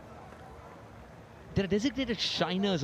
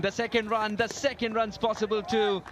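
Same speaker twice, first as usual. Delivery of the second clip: higher, louder urgent call; excited commentary; 7 semitones higher, 3 dB louder than usual. Real, not acted. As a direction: call the run as it happens. Calling the runs live.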